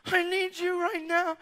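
high, slow, strained wail; crying plea. Retold or imitated. Flight attendant falling into preacher, crying. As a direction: imitate a desperate tearful begging. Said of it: "She's crying".

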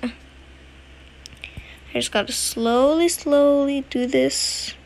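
drawn out, very slow; slow and careful. Real, not acted. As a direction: ease through it slowly. Careful slow move in game.

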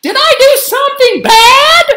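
high, loud, worried question; yelling. A narrator's reading. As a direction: yell the question to your mom. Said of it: "yelled Gabby".

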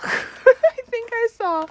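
giggling panic at a spoiler; laughing squeal. Real, not acted. Spotting a hidden photocard.

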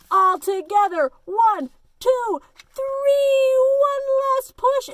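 loud, high, building count; rallying count. A narrator's reading. Animals give one last push, loud rallying call. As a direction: call out the count to heave together.

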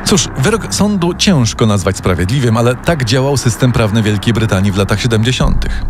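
ordinary delivery

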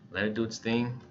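ordinary talk

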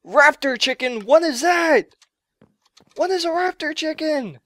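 high-pitched, baffled exclaiming; incredulous outcry. Real, not acted. Gamer just killed by raptor chicken, incredulous outcry. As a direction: cry out in disbelief.

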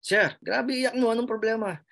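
lightly voiced guard asking what's wrong; a hint of a worried security guard; concerned guard. Guard asks why he cries.

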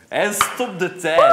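loud, slow laughing line. Real, not acted. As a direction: laugh through the words. Laughing while joking about time.

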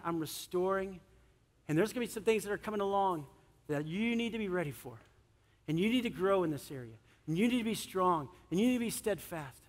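ordinary talk, nothing marked

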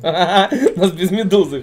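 slow words through laughter; laughing. Real, not acted. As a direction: laugh while saying it. Laughs at losing teamfight.

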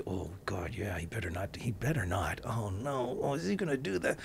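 soft, anxious murmur of a worried crowd; a hushed, fearful crowd reaction; hushed worried audience. Retold or imitated. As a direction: whisper it like a nervous audience. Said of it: "the audience, you know, with Francis went".

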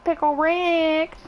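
drawn-out, high catchphrase yell; a hammed-up Pickle Rick call. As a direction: belt out the cartoon line. Streamer quotes cartoon catchphrase.

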